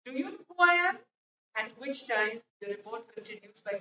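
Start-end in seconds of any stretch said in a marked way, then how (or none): 0.0-1.0 higher-pitched, mildly raised authoritative demand; a touch of imperious loudness; loud, authoritative. A narrator's reading. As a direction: read it as a man pulling rank. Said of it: "in a loud and authoritative tone said".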